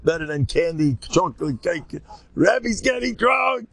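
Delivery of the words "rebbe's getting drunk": high, slow, wild Purim shout; excited shout. Retold or imitated. Telling the crowd about the drunk rebbe, high slow excited shout. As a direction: shout it out like the party's gone wild.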